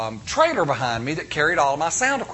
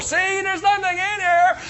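much higher, strained, slow cry; mock yell from closet; slower than usual. Parent shouting from inside the closet to a scared child. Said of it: "you're screaming at the door".

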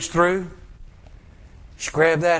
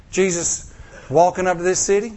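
ordinary talk

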